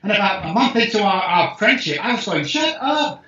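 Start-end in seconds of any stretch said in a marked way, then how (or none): 2.4-3.2 put-on "Shut up!" said high, copying the girl's habit without meaning to; a light echo of the girl's high "Shut up!"; copying her catchphrase. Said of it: "I'm copying her".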